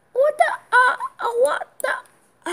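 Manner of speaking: high, laughing what the; squeaky disbelief. Real, not acted. Kid sees the game glitch, squealing disbelief. Something odd happens in game.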